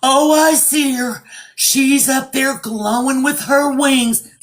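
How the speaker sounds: high, slow, breathy-free theatrical psychic voice; mock dramatic medium. Mocking TikTok mediums, slow and theatrical. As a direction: ham up the overdramatic medium, slow and lifted. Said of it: "they're being very dramatic".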